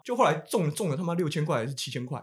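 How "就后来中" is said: normal talking voice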